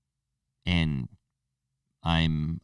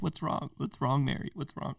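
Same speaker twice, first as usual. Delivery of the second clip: higher, softer imitation of worried friends; mock concerned friends; 9 semitones higher, 6 dB quieter than usual. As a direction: coo the question in a put-on caring voice. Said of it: "They're like".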